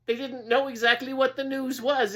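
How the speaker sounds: usual speaking voice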